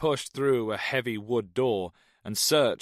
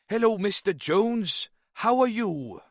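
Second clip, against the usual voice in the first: high, loud put-on Welsh greeting; fake Welsh accent; 12 semitones higher, 5 dB louder than usual. Snow greets Jones in a fake Welsh accent. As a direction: Snow hams up a Welsh lilt. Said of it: "adopted a fake Welsh accent".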